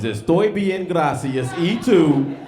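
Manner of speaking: Spanish "Estoy bien, gracias, y tú" said slowly and carefully, like a lesson; coached Spanish line. Shown as a demonstration. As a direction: teach each Spanish word clearly, pushing it a little. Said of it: "Your line is".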